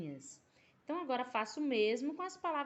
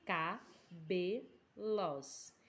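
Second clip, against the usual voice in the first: very slow, stretched out syllable by syllable; slow demo pronunciation; 4 semitones lower, much slower than usual. Shown as a demonstration. Teacher to pupils counting syllables, very slow stretched word. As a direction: draw the word out so each syllable is heard. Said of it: "pronuncie a palavra de forma natural".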